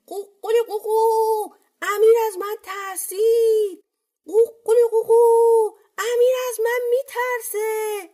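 loud, very high rooster crow; crowing rooster cry. A narrator's reading. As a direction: crow a taunt at full pitch. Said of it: "فریاد زد". (فریاد بلند و زیر خروس)

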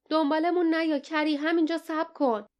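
restrained high-pitched shout to the sky; calling out. A narrator's reading. Jasmine calls to her bird overhead, a mild high shout. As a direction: call upward urgently, without full volume. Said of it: "رو به آسمان فریاد زد".

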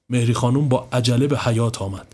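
usual speaking voice

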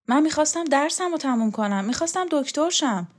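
put-on puzzled young man pressing his point; Borzu's voice. Young student Borzu speaking.

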